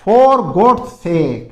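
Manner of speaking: very slow and loud; slow dictation; slow model phrase. Shown as a demonstration. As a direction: stretch each word. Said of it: "फिर देखिए".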